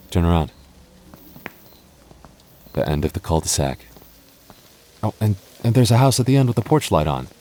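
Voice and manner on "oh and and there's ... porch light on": higher and louder, eager discovery; bursting with hope of escape; excited. Acted. Girlfriend spotting the lit porch as their way out, excited.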